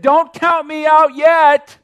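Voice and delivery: high, loud and slow; defiant, drawn out; dying dad's defiance. Retold or imitated. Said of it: "he said".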